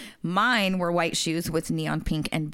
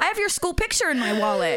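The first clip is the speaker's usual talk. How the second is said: high-pitched mock vendor voice; hammed-up family-friend vendor; imitated vendor; 11 semitones higher, faster than usual. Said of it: "She called the man by name".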